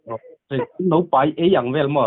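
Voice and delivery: usual speaking voice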